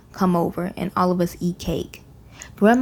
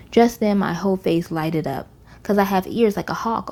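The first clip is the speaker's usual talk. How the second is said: usual speaking voice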